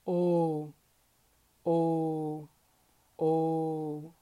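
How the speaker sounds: big round O; blended O. Shown as a demonstration. Teacher demonstrating the combined Om vowel. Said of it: "say them exactly at the same time".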